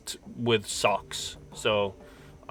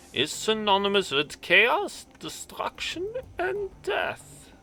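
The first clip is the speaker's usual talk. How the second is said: put-on grave, building German accent, louder and higher; a solemn German elder's warning in a heavy accent; heavy German accent rising to a hushed end; 6 semitones higher, 6 dB louder than usual. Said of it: "I had to do that in a German accent".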